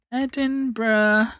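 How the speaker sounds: slow, stretched syllables; drawn-out typing aloud. Real, not acted. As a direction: say it slowly as you type it. Saying search words while typing them.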